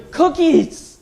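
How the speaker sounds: put-on high, loud gleeful child; excited kid. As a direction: shout it like a greedy kid. Kid's answer acted out.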